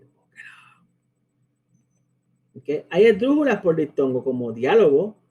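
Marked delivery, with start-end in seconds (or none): 0.0-0.5 very soft, barely audible reply; whispered aside. Acted. Teacher telling students to look it up, whispered aside. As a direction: slip in the answer under your breath. Same hushed answer repeated.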